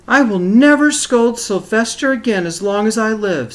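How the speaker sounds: mother's vow. Acted. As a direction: cry it out as the heartbroken mother. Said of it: "said Missus Duncan".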